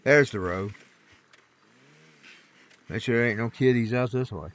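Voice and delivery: half to himself, watching the road for kittens; quiet aside. Real, not acted. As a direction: talk under your breath at the wheel. Driving, looking for a kitty.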